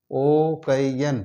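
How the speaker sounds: ओ कई एन split into three slow separate syllables. Shown as a demonstration. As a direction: stretch ओ, कई and एन apart slowly. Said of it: "जोड़कर मिलाकर पढ़ा जाए". (ओ कई एन को धीरे-धीरे अलग-अलग सिलेबल में बोलना)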